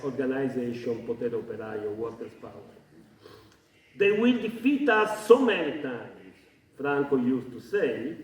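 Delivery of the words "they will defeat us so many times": raised, rousing rhetorical quotation; rhetorical quote. Retold or imitated. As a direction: quote it with a rhetorical lift in pitch. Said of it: "with a bit of rhetoric".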